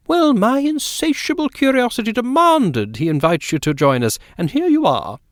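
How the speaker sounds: a hint of a chatty, insistent lady of the house; genteel hostess talking over her guests; lightly voiced society lady. A narrator's reading. Narrator voices Mrs Fortune.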